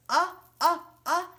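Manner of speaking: high, loud "Uh, uh, uh"; short u sound. Shown as a demonstration. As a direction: model the short u for children. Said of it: "Umber says".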